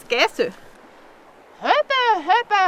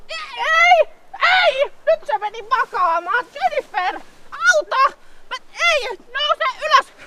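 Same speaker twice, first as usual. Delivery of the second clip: high, alarmed cries for help; high-pitched flustered pleading with a stubborn camel; panicked pleading; 13 semitones higher, slower than usual. Acted. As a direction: beg the camel to get up, rising panic. Camel lies down, she panics.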